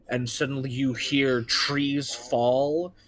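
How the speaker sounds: ordinary talk, nothing marked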